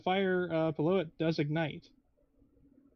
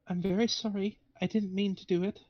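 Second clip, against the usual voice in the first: timid, higher-voiced apology; hesitant; 5 semitones higher than usual. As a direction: apologise haltingly, in character. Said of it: "a lot of hesitation in that voice".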